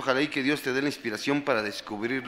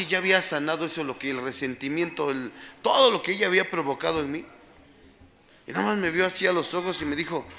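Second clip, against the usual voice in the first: usual speaking voice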